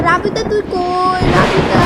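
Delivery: loud slow call across the house. Acted. Mahi calls Rabita during the storm, loud slow calling. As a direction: shout for your friend to come.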